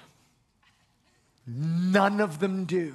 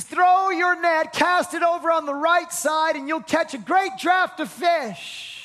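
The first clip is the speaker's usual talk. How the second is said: Jesus calling to the fishermen, higher and louder; 8 semitones higher, 4 dB louder than usual. Acted. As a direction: shout the order out to the boat. Figure calls out instructions.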